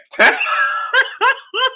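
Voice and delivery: very high wheezing laugh; helpless laughter. Real, not acted. Host trying to quote Bob, collapsing into laughter. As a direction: burst out laughing mid-sentence.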